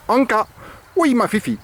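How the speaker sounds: voix haute et joyeuse pour rappeler la chienne; appel enjoué. Real, not acted. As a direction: appelle la chienne avec entrain, plus fort. (appel joyeux et aigu)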